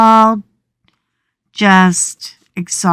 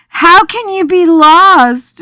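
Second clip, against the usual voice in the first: husband's disbelief; 10 semitones higher, 5 dB louder than usual. Retold or imitated. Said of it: "And he goes".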